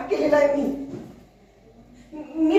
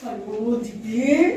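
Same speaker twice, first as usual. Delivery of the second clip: normal talking voice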